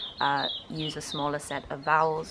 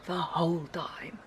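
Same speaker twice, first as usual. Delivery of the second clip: imitated South African accent. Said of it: "he's putting a schwa".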